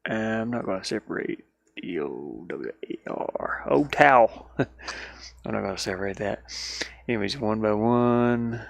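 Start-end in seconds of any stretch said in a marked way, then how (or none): none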